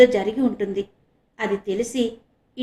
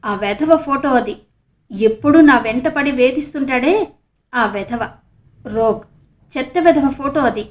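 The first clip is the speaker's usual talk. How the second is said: spiteful cursing, louder; 3 semitones higher, 6 dB louder than usual. A narrator's reading. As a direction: spit out the insults with grudge. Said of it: "కసిగా తిట్టుకుంటూ చెప్పింది".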